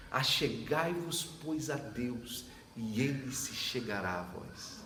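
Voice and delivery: slow, solemn verse reading; scripture recitation. Acted. Pastor quoting scripture to Johnny, slow and solemn. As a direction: lay out each word of the verse.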